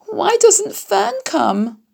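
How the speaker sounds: very high, slow pleading little pig voice; piglet close to tears. A narrator's reading. Wilbur longing for Fern to rescue him, high and tearful. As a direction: plead like a lost baby pig on the verge of crying. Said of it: "He began to cry".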